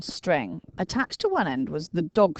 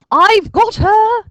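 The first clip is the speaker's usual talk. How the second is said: high, loud, slow triumphant yell; shouted; 12 dB louder, much slower than usual. A narrator's reading. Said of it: "he shouted".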